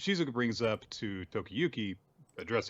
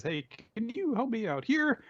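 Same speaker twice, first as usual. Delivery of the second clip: much higher, lightly put-on young man asking for help; light character voice; 13 semitones higher than usual. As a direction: ask casually, in a lighter voice. Quoting Tokiyuki's request.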